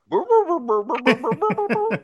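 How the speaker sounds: high bubbling boos, laughing; underwater voice. Pretend. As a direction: gurgle it as if underwater. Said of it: "I gotta sound like I'm underwater".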